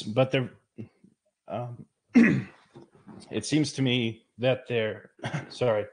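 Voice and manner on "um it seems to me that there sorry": choking on water, coughing between words; sputtering after water down the wrong pipe. Real, not acted. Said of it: "water went down the wrong pipe".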